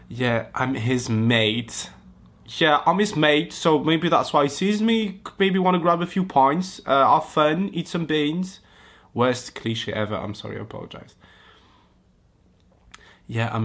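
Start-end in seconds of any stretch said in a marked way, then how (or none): 2.6-8.6 hammed-up British lad, higher and louder; playful British caricature, raised voice; mock British accent. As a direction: put on a jokey, pitched-up British accent. Riffs on mate in British caricature.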